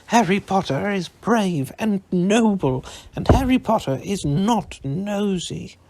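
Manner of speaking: high, loud loyal elf; fierce elf. A narrator's reading. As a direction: defend him fervently. Dobby defends Harry.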